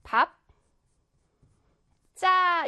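밥, long pause, then tensed 자 like 짜; model pronunciation. Shown as a demonstration. Teacher showing pupils how to read it, model pronunciation of 밥 자 as [밥짜]. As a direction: say 밥 자 as [밥짜], syllables far apart. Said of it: "어떻게 읽냐면".

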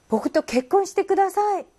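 slow, earnest plea, pitched high; proposing. Acted. A man proposing to a woman, slow and earnest. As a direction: beg her to marry you.

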